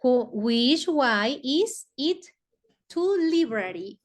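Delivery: very slow, careful English; English model sentence. Shown as a demonstration. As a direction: stretch it out for the class. (lectura muy lenta del inglés)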